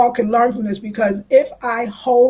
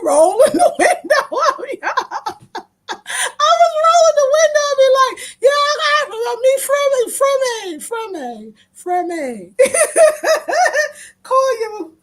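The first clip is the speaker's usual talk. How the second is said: laughing retelling; 13 semitones higher than usual. Real, not acted. Telling viewers about rolling up the window, giggling hard. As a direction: crack up through the words.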